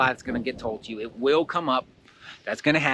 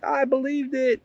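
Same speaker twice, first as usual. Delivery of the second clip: acted overwhelm; 13 semitones higher than usual. Owning up after being overwhelmed by the lie, high mock lament. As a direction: play overwhelmed in a much higher voice. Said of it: "it might overwhelm you".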